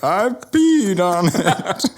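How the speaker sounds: high, drawn-out comic villain impression; hammed-up high villain voice, close to laughing; Bane. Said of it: "Have you guys seen the Bane cat?".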